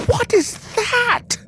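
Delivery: very high, slow, strange disgusted squeal; shocked. Acted. Bunny biting the mustard greens, shocked. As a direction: recoil at the taste.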